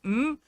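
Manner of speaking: one brief hum through sealed lips; sewn-shut hum. Acted. Demonstrating the insulting fourth hum, short muffled mm. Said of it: "and the fourth".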